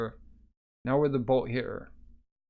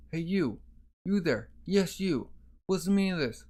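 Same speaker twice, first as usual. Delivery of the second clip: high-pitched acted challenge; calling out sharply; 6 semitones higher than usual. Guard challenging the man standing by the body, demanding. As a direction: call them out in a raised pitch.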